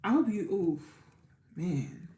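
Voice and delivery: ordinary talk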